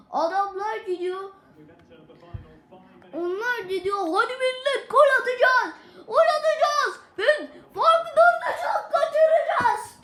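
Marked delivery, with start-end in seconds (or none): 4.0-10.0 high, loud wailing rally; rally them, then crack up in despair; exasperated rally cry. Real, not acted. Gamer mocking his team's chances, high wailing cry.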